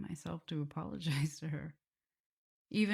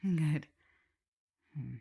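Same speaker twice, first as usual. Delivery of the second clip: ordinary talk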